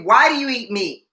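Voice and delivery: ordinary talk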